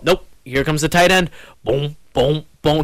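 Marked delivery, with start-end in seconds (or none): 1.6-2.8 imitated tuba booms. Host mimics a tuba for the fumbled game, mock tuba booms. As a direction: boom it out like a tuba thumping. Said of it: "Get the tuba playing".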